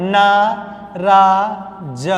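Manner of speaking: नाराज stretched very slowly, every sound held; drawn-out teaching pronunciation. Shown as a demonstration. Teacher dictating the correct spelling, very slowly. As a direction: draw out नाराज syllable by syllable. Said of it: "होता क्या है".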